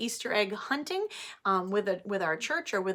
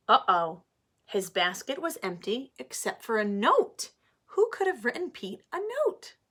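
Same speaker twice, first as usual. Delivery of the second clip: put-on reading to children; 3 semitones higher, 4 dB louder than usual. Teacher reading Pete the Cat aloud to her class, animated. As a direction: read it like story time for little ones.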